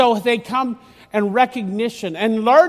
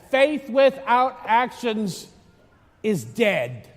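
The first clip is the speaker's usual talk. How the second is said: very slow, weighty delivery; solemn quote; much slower than usual. Real, not acted. Priest quoting Saint James, slow and solemn. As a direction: let each word land.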